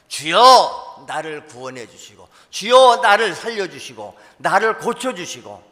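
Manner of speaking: loud, high pleading cry of Lord, save me; crying out to God. Pretend. A believer cries out to the Lord, loud and high.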